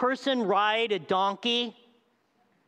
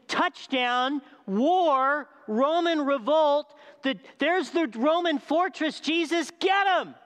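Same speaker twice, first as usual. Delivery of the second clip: eager war cries; 5 semitones higher, slower than usual. Retold or imitated. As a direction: cheer for the revolt like a hyped-up fan. Crowd imagines war cries.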